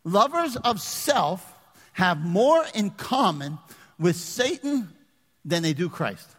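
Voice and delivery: each phrase drawn out slowly with pauses; slow emphatic repeat. Shown as a demonstration. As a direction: hammer it home slowly. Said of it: "I'm gonna say this to you".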